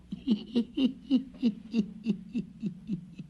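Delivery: high, far-off witch cackle; witch's cackle. A narrator's reading. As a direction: cackle away as you vanish. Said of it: "with a cackle".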